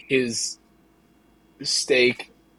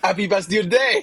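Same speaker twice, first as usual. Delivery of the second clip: put-on French accent; 5 semitones higher, faster than usual. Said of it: "I can only do the French accent".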